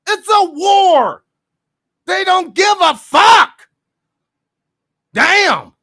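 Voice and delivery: high, loud outraged shouting, some words slowed; real furious yelling. Real, not acted. Reacting to the documentary, furious shouting at the screen. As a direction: explode at the screen in disbelief. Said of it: "I'm yelling and shit".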